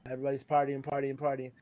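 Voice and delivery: usual speaking voice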